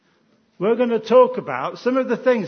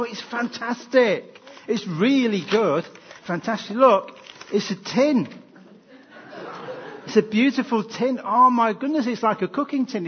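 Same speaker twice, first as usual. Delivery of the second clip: overdone gasping joy at a gift tin, a touch higher; hammed-up delight; 4 semitones higher, 4 dB louder than usual. Leader showing the children a Christmas tin, in put-on delight. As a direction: play it up like a thrilled child opening a present.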